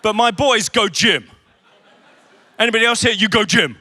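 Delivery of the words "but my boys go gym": "go gym" zonder "to the", als jongensslang; slangy go gym. Shown as a demonstration. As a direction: zeg "go gym" kort, zonder lidwoord. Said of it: "my wife goes to the gym".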